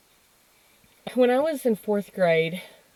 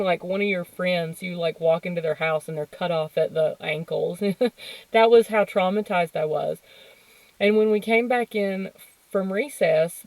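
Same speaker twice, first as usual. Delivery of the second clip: normal talking voice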